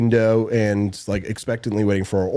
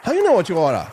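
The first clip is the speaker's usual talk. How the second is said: higher put-on Chinese accent, laughing into it; mock Chinese accent; 7 semitones higher, faster than usual. Family inside joke retold, high mock Chinese accent. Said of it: "enough with the racist jokes".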